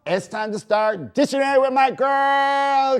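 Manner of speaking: tipsy excitement. Real, not acted. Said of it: "we are tipsy".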